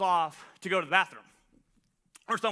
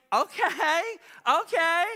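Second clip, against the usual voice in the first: high, eager mock "okay, okay"; half-hopeful, half-nervous pitch jump; nervous excitement; 9 semitones higher, 4 dB louder, much slower than usual. Nervous self-quote as girls giggle.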